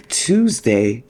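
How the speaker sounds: word stretched through a yawn. Real, not acted. Host caught by a yawn while listing the week's data, yawning. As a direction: draw the word out as a yawn takes over. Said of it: "oh, here we go with the yawning".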